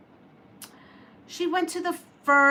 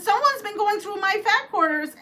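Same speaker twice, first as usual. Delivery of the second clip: high mock-dismayed mama bear; 10 semitones higher than usual. Mama Bear checking her fabric, high dismay. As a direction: fuss over the fat quarters.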